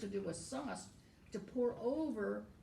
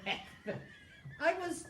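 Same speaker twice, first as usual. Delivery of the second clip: laughing; 8 semitones higher than usual. Real, not acted. Laughs mid-sentence.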